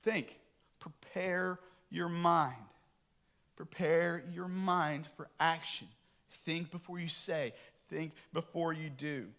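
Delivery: slow, measured counsel; slow, deliberate. Retold or imitated. Father slowly counselling his kids. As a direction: lay out each word slowly like wise advice. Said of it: "I'm saying".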